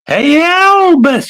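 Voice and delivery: calling out high and long across the water; loud high call. Retold or imitated. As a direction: hail him from a distance, stretched out. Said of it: "she's going".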